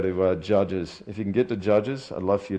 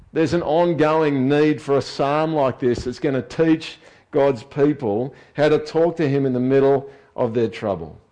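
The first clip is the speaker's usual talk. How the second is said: louder, raised preaching voice from the Gideon reading on; full-force preaching; 6 semitones higher, 6 dB louder than usual. Acted. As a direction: drive the sermon home with conviction.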